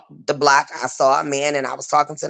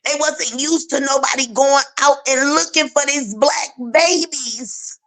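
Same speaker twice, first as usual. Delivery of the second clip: high, strained voice through tears; pushing words out while crying; tearful; 8 semitones higher than usual. Real, not acted. Still grieving, voice strained high.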